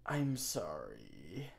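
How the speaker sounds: whispered mock pity; soft sympathetic whisper. Real, not acted. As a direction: gently whisper an apology to the wounded. Apologizing to wounded enemy.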